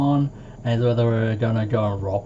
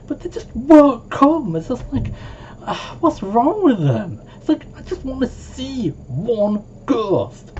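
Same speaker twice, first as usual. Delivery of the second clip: whiny complaint; 13 semitones higher, 3 dB louder than usual. Real, not acted. Whining about missing ghosts.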